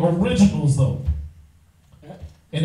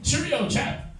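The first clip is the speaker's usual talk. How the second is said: a hammed-up British accent, pitched higher; British accent spoof, higher and lightly voiced; mock British accent; 6 semitones higher, 4 dB quieter, slower than usual. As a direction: tease them in a posh British accent. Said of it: "Jesus British".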